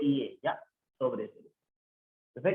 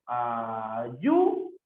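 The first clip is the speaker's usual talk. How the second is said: "ayúdalo" in slow pieces, YÚ swooping up; syllable separation; 6 semitones lower, much slower than usual. Shown as a demonstration. Teacher showing the separación, "ayúdalo" in slow separate syllables. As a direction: break "ayúdalo" into syllables, lifting YÚ. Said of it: "cómo sería en ayúdalo".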